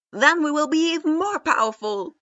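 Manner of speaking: high, loud battle cry; loud declaration. A narrator's reading. Naruto pumping his fist, vowing to his team, very loud and high. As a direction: roar it out with a fist in the air. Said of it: "Naruto declared loudly".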